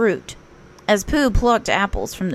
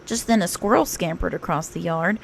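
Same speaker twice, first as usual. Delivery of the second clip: plain reading voice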